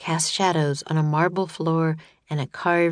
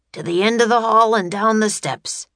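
loud, raised growled order; grouchy growl; 5 semitones higher, 5 dB louder than usual. A narrator's reading. Grouchy guard marching prisoners out, loud growl. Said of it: "growled the grouchy guard".

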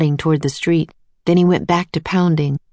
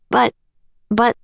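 stammered; 5 semitones higher than usual. A narrator's reading. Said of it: "stammered Jack".

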